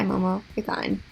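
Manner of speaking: normal talking voice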